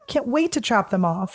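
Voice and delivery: usual speaking voice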